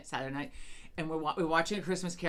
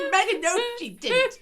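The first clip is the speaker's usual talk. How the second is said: high, laughing denial; husband telling Megan no; incredulous husband; 14 semitones higher, 7 dB louder than usual. Retold or imitated. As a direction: deny it gravely. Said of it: "And he's like".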